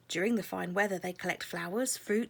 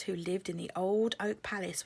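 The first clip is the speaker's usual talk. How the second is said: usual speaking voice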